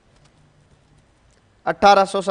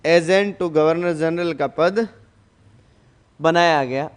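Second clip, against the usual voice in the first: very slow, word by word; dictating; 2 semitones lower, slower than usual. Shown as a demonstration. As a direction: drag each word for the writers. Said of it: "teacher dictating notes".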